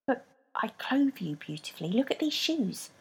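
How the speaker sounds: soft whisper; whispered plea. A narrator's reading. Rebecca defending her shoes, soft whisper. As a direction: defend herself softly.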